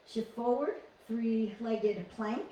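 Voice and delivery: ordinary talk, nothing marked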